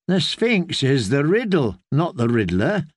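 plain reading voice

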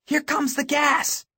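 very high, alarmed warning cry; urgent yell. A narrator's reading. Girl warns boy of falling gas, yelling. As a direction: yell the alarm over a crowd. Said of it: "she yelled".

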